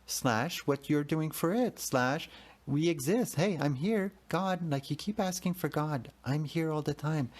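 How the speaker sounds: voice of God. Retold or imitated. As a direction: scold yourself kindly as God would. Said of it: "And I heard his voice".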